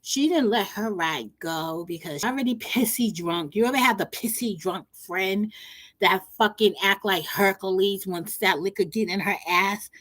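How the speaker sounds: ordinary delivery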